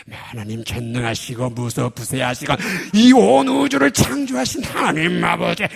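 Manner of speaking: booming, slow, grand prayer voice; loud formal prayer. Shown as a demonstration. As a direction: proclaim the prayer like a pulpit showpiece. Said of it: "이러지 마시고".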